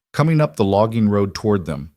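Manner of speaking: ordinary delivery, nothing marked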